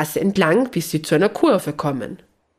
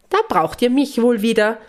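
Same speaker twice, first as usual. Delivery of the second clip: proud; 6 semitones higher than usual. Acted. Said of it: "sagt Lisabella stolz".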